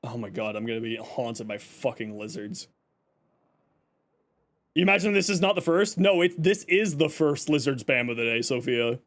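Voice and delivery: ordinary delivery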